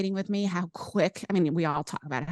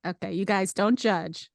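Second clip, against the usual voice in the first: normal talking voice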